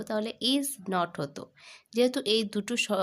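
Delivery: ordinary talk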